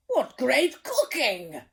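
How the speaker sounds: loud, slow delighted praise; hearty praise. Acted. King praising the meal, loud and delighted. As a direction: relish every word.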